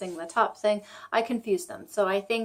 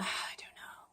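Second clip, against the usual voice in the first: whisper; 13 dB quieter than usual. Real, not acted. Muttered aside while reading card.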